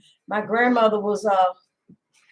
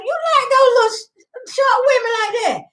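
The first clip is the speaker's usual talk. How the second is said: high, squeaky teasing of her husband; mock-scolding a husband over his favorite show; teasing jab; 10 semitones higher than usual. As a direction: needle him playfully in a raised voice. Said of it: "and I said".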